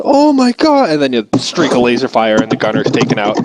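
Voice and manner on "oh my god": high loud gasp of shock; shocked terror; horrified gunner. Retold or imitated. Gunner seeing laser fire, horrified. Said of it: "the person above goes".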